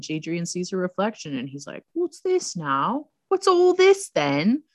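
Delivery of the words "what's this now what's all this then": drawn-out, high British voice; hammed-up British questioning; British accent. Jadrian seeing her reflection in the goblet, hammed-up British accent. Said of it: "that was a good British accent".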